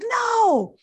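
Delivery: very high, loud shocked no; attending jokingly shocked at Michigan; mock disbelief. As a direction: act stunned in fun.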